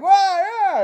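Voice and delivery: high wailing mock-sob of Hanks; imitating Hanks crying. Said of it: "he just kinda sounds like".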